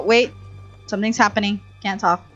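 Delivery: usual speaking voice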